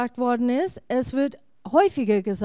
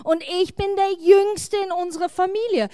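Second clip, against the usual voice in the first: crying out; 6 semitones higher than usual. A narrator's reading. Gideon protesting he is too small, high-pitched crying out. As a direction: plead the case in a raised pitch. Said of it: "rief Gideon".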